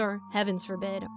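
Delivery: ordinary delivery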